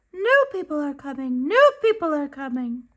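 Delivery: high, fast, squawky seagull cries; squawking seagulls. A narrator's reading. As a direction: screech it out like gulls circling overhead.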